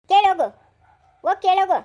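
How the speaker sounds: loud, furious yell of defiance; angry shout. Acted. Mother shouting back at her son in a quarrel, furious. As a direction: hurl it back at him full voice.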